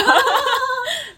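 突然爆出一阵尖声大笑; 笑到停不下来，声音拔高; 高声大笑. Real, not acted. Laughing at foolish customers. (尖声爆笑)

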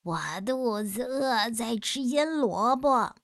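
a hint of sly monster, slow on the end; lightly voiced monster. Monster lying to the boys in the dark, slow and sly. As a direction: fob them off slowly, sly.

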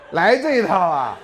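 slow, loud, laughing disbelief; laughing "来这一套啊" slowly. Real, not acted. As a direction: laugh it out, amused at the nerve. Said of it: "我姜是老的啦".

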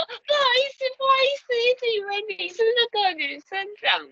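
mock high apologetic voice; embarrassed caller apology.

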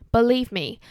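ordinary talk, nothing marked

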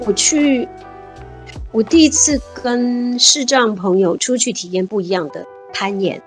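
usual speaking voice

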